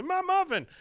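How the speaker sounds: Dale's muffin grabbed, a sudden high whine; a high, wounded yelp over the lost muffin; squealing high whine. Retold or imitated. Dale whines high as Hank takes his muffin. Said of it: "and Dale goes".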